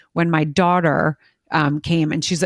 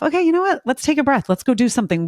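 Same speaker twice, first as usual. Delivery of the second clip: reassuring parent voice; 7 semitones higher, faster than usual. Retold or imitated. Parent soothing a struggling child.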